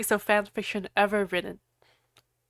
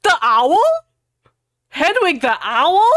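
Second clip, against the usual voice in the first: disbelief; 7 semitones higher, 7 dB louder than usual. Real, not acted. Reader reacts to absurd line.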